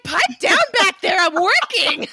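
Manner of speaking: high-pitched playful telling-off; mock scolding. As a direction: pretend to shush a noisy colleague, amused. Listener plays the interrupted author scolding.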